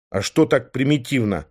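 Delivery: ordinary delivery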